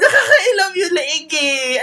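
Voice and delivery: slow, high giggly words; laughing. Real, not acted. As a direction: laugh through it, charmed. Laughing reaction to singer.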